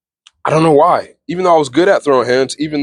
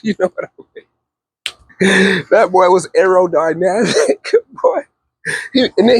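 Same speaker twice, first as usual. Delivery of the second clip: laughing; 4 semitones higher, slower than usual. Real, not acted. Laughing at paddle memory.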